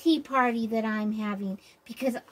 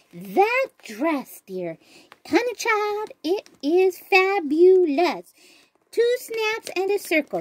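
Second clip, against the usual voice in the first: higher, louder diva gush; louder, higher sassy compliment; sassy praise; 6 semitones higher, 6 dB louder, slower than usual. Pretend. Sassy hostess praising guest's dress.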